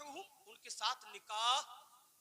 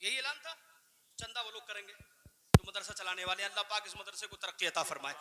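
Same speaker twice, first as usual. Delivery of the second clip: ordinary talk, nothing marked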